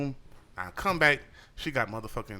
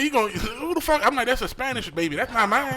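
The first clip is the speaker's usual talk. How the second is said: incredulous rant; 9 semitones higher, 4 dB louder than usual. Retold or imitated.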